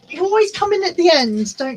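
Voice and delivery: high cooing voice to the cat; high sing-song voice for a pet. Real, not acted.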